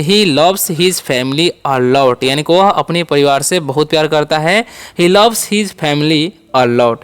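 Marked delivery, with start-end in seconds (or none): 0.0-2.2 each word of "He loves his family a lot" said very slowly; slow model sentence. Shown as a demonstration. Teacher modelling an English sentence slowly for learners. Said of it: "sentence बनाना चाहेंगे तो बोलेंगे". 5.0-7.0 "He loves his family a lot" repeated slowly with stress on loves. Shown as a demonstration. Teacher repeating the model sentence slowly for learners. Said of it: "ऐसे बोल सकते हैं".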